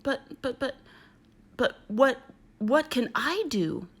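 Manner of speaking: slow, stammering, mock-tearful crocodile; mild crying stammer. Crocodile flustered by the kangaroo's tears, mild weepy stammer. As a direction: sputter helplessly, a touch weepy. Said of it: "The crocodile began to cry too".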